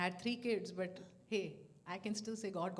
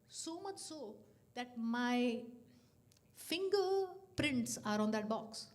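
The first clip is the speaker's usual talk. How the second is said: slow emphasis; 4 semitones higher, 5 dB louder, much slower than usual. Real, not acted. Emphatic marvel at molten box.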